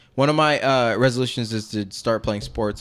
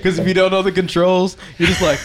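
laughing; 10 semitones higher, 8 dB louder than usual. Real, not acted. Describing button mashing, laughing.

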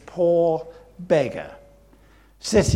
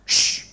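loud hushing "shh"; sharp shush; 4 dB louder than usual. Acted. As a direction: shush a noisy man in the crowd. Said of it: "the crowd around him said".

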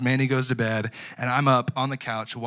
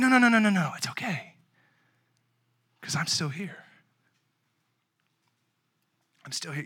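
God soothing; 4 semitones higher than usual. Pretend. God comforting worried people, gently fading. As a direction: reassure gently, trailing off. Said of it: "that's when God's saying".

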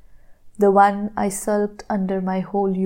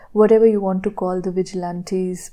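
usual speaking voice

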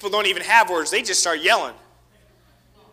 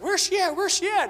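high, searching call; a child hunting for a sibling, high voice; child's searching call; 12 semitones higher than usual. Retold or imitated. Kid seeking.

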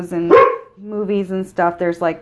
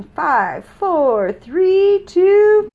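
countdown; 9 semitones higher, 4 dB louder, much slower than usual. Pretend. Countdown to the break.

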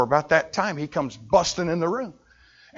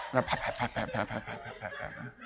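whispered pat pat; 4 semitones lower, 14 dB quieter, faster than usual. Retold or imitated. Mimicking hand patting.

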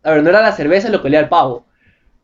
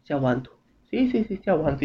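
much softer, slurred self-pep; drunk mirror murmur; 3 semitones lower, 11 dB quieter than usual. Retold or imitated. Drunk man at the bathroom mirror, murmuring. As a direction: mumble to yourself in the mirror. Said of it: "te quedas mirando así".

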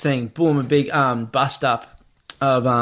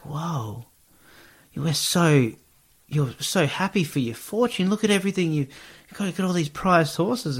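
higher, thrilled congratulations; gushing neighbours; 5 semitones higher than usual. Retold or imitated. Neighbours congratulate the farmer on his horses, higher and thrilled. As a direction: play the neighbour marvelling at his luck.

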